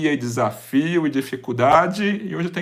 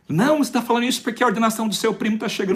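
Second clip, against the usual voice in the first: higher, lightly voiced scolding mother; imitating his mother; 9 semitones higher, faster than usual. Mother brushing off her son's wish, imitated higher.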